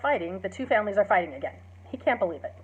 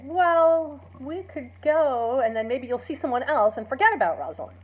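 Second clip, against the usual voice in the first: eager coaxing; 5 semitones higher, 5 dB louder than usual. Retold or imitated. Friends persuade Romeo.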